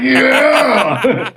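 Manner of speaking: big, drawn-out high shout; loud imitation of a whooping crowd; crowd cheer. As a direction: cheer like a thrilled audience. Acting out a thrilled crowd.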